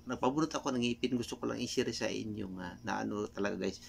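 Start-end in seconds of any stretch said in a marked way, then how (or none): none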